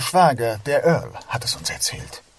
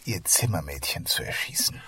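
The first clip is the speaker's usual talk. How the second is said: hushed; 9 semitones lower, 8 dB quieter than usual. Acted. Dramatic question to the Earl.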